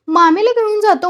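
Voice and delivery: shrieked echo. A narrator's reading. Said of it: "म्हणताच रंगू किंकाळली".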